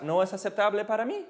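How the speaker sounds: "No es aceptable para mí" run together quickly; fast demonstration. Shown as a demonstration. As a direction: rattle off "No es aceptable para mí" as one phrase. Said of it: "And if you say it fast".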